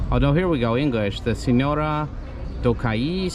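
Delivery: ordinary talk, nothing marked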